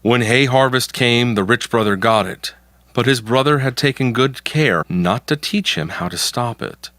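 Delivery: plain reading voice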